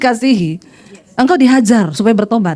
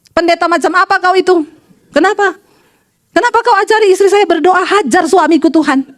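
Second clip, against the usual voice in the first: high-pitched mock outrage; indignant husband; 5 semitones higher than usual. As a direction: imitate a husband demanding an explanation, voice raised in pitch. Indignant husband phones the pastor. (suami protes marah, suara meninggi)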